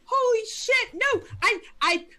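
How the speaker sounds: high, loud frightened outburst; panicked yelling. Acted. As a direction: blurt it out in panic. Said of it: "she goes".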